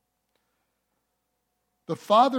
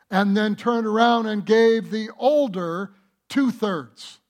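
loud, high, driving sermon voice; full-force preaching; 4 semitones higher, 6 dB louder than usual. Real, not acted. Pastor pressing the inheritance point home in full-force preaching. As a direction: preach it hard to the congregation.

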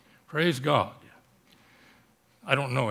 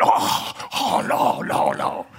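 breathy whispered panic, imitating the old man; freaking out; 3 dB louder, slower than usual. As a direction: mimic a frightened whispered protest. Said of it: "he was freaking out".

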